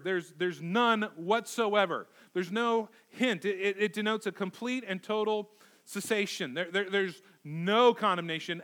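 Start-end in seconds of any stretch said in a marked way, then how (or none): 0.0-3.4 slow, weighty emphasis on none and hint; slow emphatic. Shown as a demonstration. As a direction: drag out "none whatsoever" and "no hint". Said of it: "very emphatic word".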